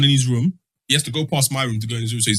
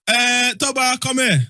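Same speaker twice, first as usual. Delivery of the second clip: higher calling voice as dad; 8 semitones higher, much slower than usual. Retold or imitated. Dad calls his son over from the football. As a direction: call the kid over like his father.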